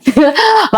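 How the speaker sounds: short high giggle, embarrassed; shy giggle. Real, not acted. Soldier giggling shyly as the host teases her about her boyfriend. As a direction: laugh it off shyly after teasing.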